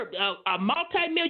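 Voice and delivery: usual speaking voice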